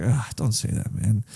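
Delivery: a hint of a wounded old father's voice; heartbroken plea. Father hurt by his son's rejection, mild heartbroken plea. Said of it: "You could just hear it in his voice".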